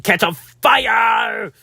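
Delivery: slow, high, loud mock alarm; hammed-up cry of doom, dragged out; dramatic shout. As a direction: yell the warning like a cartoon alarm. Dramatic warning about burning wire.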